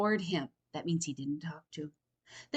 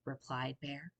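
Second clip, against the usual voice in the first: usual speaking voice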